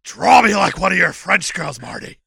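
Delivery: loud mock dramatic request; hammed-up movie quote. Riffing on Titanic for co-host, loud parody voice. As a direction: belt it out, breaking into a laugh.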